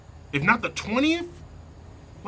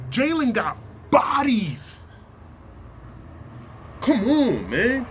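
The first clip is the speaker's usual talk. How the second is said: emphatic shout; 3 semitones higher, slower than usual. Real, not acted. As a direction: hammer each word out slowly. Heated sports rant, insisting.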